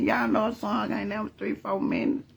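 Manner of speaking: ordinary talk, nothing marked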